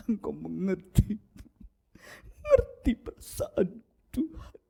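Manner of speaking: high, slow fake sobbing plea; hammed-up weepy prayer voice; mock crying prayer. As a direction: whimper it like fake tears. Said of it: "bahasanya seolah-olah nangis".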